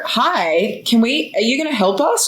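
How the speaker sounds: high-pitched, imploring appeal for help; retold exasperated plea. Retold plea through the glass.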